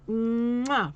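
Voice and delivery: loud smacking kiss sound; blown kiss. Pretend. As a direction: blow a big kiss to the audience. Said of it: "Remember how they used to do that on the dating game".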